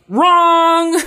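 high, loud, held-out call; hammed-up buzzer-like correction; mock yell. Nobody on set stopping the actor, high mock yell. Calling out the bad acting.

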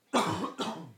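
single sharp cough. Real, not acted. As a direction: clear the throat with a cough. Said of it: "scusate la tosse".

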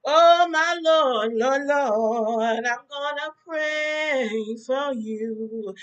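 slow, sung-out preacher lament; preacher's closing. Acted. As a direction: draw it out like a sermon's last word. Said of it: "Y'all preachers have third clo".

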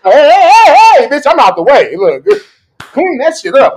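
high, loud panicky shouts; panicked yelling. Retold or imitated. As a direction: shout as you bail out. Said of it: "I'm like".

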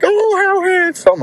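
storyteller mimics the girlfriend's bedridden mom asking to see him, high and slow; mimicking the mom. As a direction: squeak it out like a frail bedridden mother. Imitates the bedridden mother.

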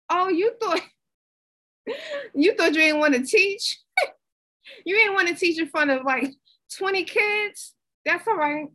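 teasing God voice. Retold or imitated. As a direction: tease her like God caught her out. God teasing her about teaching.

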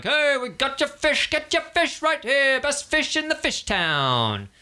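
high-pitched market hawker calling out fish; fishmonger hawking. Acted. Market fishmonger to the crowd, high hawker call. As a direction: yell it like a stallholder pushing fish. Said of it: "someone selling like".

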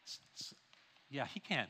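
quiet confession of a plain truth; hushed aside. Real, not acted. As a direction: drop to a near whisper. Hushed aside answering own question.

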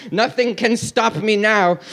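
usual speaking voice

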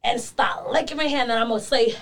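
ordinary delivery

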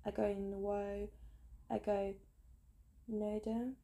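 "Ego Nwo-" broken off, then retried in pieces; halting practice of the W in "Nwodim"; struggling with W. Shown as a demonstration. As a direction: sound out "Nwodim" slowly after a separate "Ego". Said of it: "it's got a W".

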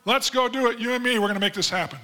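hammed-up enthusiasm, words tumbling fast at the end; mock eager. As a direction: play an overeager volunteer, rushing the last line. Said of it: "Is that what he said?".

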